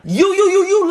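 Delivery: high, loud sputtering "you, you, you"; mock sputtering; flustered stammer. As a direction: trip over the words in outrage. Stammering in sibling roast.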